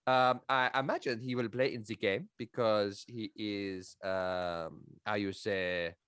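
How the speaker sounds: put-on lightly done French accent, questioning lilt; a hint of a French scout, every word a question; French accent impression. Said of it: "classic French impression".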